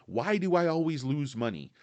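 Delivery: high-pitched mock lament of a losing investor; self-questioning loser. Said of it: "if you're asking yourself".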